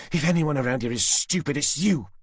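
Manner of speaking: high, cold threat; Susan's menacing voice. A narrator's reading. Susan threatening Tanner with the knife at Jenny's throat. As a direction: savour the threat.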